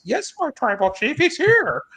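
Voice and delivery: high-pitched mock deferential voice; imitated obedient aide. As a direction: answer the chief in a put-on high voice. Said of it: "and he goes".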